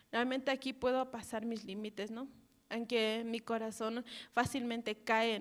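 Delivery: ordinary talk, nothing marked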